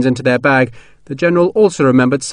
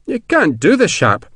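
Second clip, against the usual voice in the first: high-pitched, flustered protest; object, pitched right up at the start; embarrassed protest; 8 semitones higher, slower than usual. A narrator's reading. Colonel embarrassed by sergeant's generous gift, protesting. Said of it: "McCandless protested, embarrassed by the generosity".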